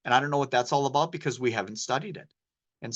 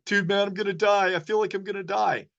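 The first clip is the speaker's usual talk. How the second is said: mock panic; 8 semitones higher than usual. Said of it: "they're like".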